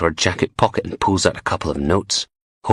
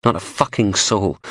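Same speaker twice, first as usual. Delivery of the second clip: ordinary delivery, nothing marked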